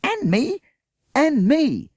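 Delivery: high loud repeated shout. Acted. Another child bowled over, shouting. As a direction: yell it across the field. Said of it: "The shout echoed across the fields".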